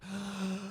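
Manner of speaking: soft, drawn-in gasp of dread; mock gasp. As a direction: gasp like you've been caught. Imitates catfish's shocked reaction.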